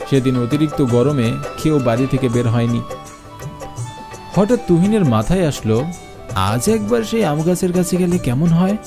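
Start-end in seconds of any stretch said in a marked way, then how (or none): none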